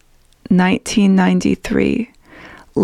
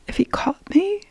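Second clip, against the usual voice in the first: high frightened whisper; fearful whisper; 11 semitones higher, 4 dB quieter than usual. A narrator's reading. Scared child narrator whispering her fear. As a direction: hush it as if he might hear.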